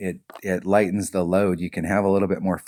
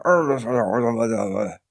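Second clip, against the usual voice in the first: mock pirate growl; 3 semitones higher, 4 dB louder than usual. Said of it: "I'll stand up and go".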